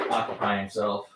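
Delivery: ordinary delivery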